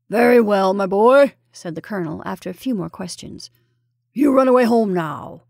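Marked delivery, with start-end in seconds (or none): 0.1-1.3 loud, commanding officer's voice; hearty colonel. A narrator's reading. Colonel dismisses the frightened boy. 4.1-5.4 put-on loud, firm send-off to a child; officer's hearty dismissal; commanding colonel. A narrator's reading. Colonel sends the boy home.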